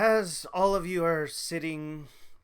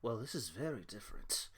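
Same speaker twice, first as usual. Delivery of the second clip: much lower and softer, subdued; suddenly sober; 8 semitones lower, 14 dB quieter, faster than usual. Acted. Halfling suddenly finds himself sober, quiet and subdued. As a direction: drop to a quiet, sobered-up realisation. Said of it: "You're now sober".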